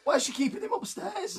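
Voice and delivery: high squeaky question, real voice crack. Real, not acted. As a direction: squeak it like a breaking adolescent voice. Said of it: "[name]'s balls just dropped".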